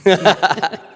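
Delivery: a loud, high laugh at mom's answer. Real, not acted. Host laughs at mom's joke.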